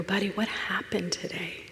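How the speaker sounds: mother lowering her tone to her son, softly. Retold or imitated. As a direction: ask it quietly to a hurt child. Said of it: "I lower my tone".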